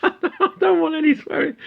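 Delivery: high-pitched words through laughter; real giggle lifting the voice; laughing. Real, not acted. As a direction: break up laughing while talking. Laughing at own skit.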